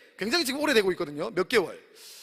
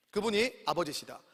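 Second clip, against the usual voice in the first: normal talking voice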